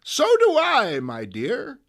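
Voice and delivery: high sweet granny reply; old lady voice. Acted. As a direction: answer fondly. May answers youth.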